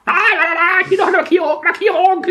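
high, laughing market barker; market crier. Pretend. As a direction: hawk your wares like a market crier. Hawking wares like market crier.

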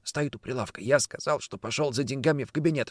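hushed voice into the receiver; whispering on the phone. Acted. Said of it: "прошептал в трубку хриплый голос". (шёпот в трубку)

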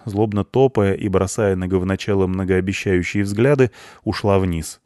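usual speaking voice

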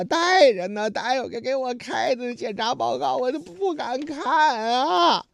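wailing patient. Acted. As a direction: whine it out, dreading the report. 剧中病人以为得了绝症. (假装哭腔，拖长声哀号)

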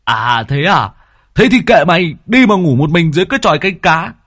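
high, loud, fast huffy outburst; giận dỗi lật lọng. Acted. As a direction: storm off in a sulk. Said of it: "liền lật lọng". (giọng cao, to, dỗi hờn)

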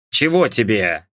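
lightly voiced grumpy husband; mildly disgruntled, answering a summons; displeased. A narrator's reading. Father called in by mum, mildly displeased. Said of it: "недовольно спросил отец".

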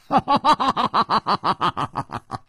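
long falling laugh; a big open laugh that trails off; hearty laugh. Acted. Nan Gongling laughing off any ambush.

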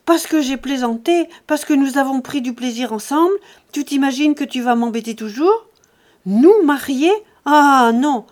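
loud, scornful tirade; angry, emphatic dismissal; furious rejection. A narrator's reading. Jacqueline furiously rejects the man.

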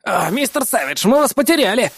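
high excited voice over comms; agitated caller. Acted. Isamu relieved to reach the hero.

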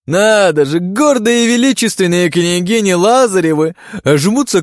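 high, loud mocking sneer; sneering panto villain. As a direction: sneer down at the intruder. Arrogant lord insults the wives.

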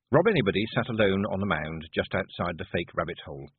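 usual speaking voice

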